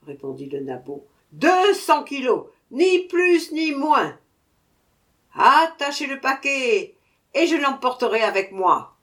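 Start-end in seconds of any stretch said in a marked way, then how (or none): 1.3-9.0 loud high insistent order; insist, no argument; dwarf insisting to the merchant. A narrator's reading.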